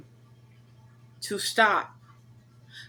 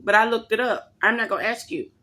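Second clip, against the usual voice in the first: normal talking voice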